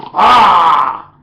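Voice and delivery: loud, forceful shout; power shout. Shown as a demonstration. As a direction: act like you'll rip the ball. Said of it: "like you're gonna rip that ball".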